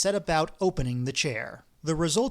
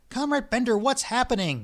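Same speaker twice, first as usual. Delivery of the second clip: higher, frightened cry; cry in terror; 8 semitones higher, 3 dB louder than usual. Acted. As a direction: call out scared as the building shakes. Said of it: "cried Ippolit Matveyevich in terror".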